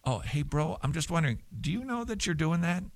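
high, soft, offhand question; casual quick query. Acted. Host models a quick word to a church friend, soft casual query. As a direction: float it lightly and quietly to a buddy. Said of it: "It could just be a quickie".

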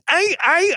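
very high, repeated mock stabs; imitated stabbing noises. Preacher mimics flatterers turning on Jesus, high stabbing noises. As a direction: squeal out quick stabbing sounds.

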